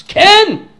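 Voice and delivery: high, loud shouted "Ken!"; loud eager answer; emphatic yes. Retold or imitated. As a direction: fire it back instantly.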